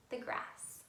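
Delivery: plain reading voice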